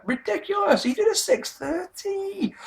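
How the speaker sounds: very high, stretched-out squeal of amazement; incredulous high-pitched excitement; amazed raving. Real, not acted. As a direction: marvel at it in a high, slow voice. Raving about a wrestling move.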